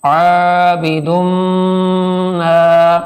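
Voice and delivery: عابد said slowly with the first vowel drawn out; slow recitation, عابد elongated; stretched vowels. Shown as a demonstration. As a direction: hold the aa in عابد for one alif length. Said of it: "اس کو دونوں کو کھینچ کے پڑھنا ہے".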